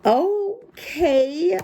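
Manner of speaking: put-on high child voice giving in; reluctant kid. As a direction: give in like a small boy. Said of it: "I said finally".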